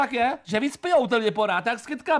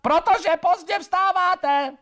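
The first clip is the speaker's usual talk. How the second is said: higher, louder, slowly spaced "Protože pozdě vstáváte"; loud slow repeat; 8 semitones higher, much slower than usual. Acted. Wife repeating it for the deaf husband, loud and slow. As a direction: shout it slowly so he hears. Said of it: "Neřvi".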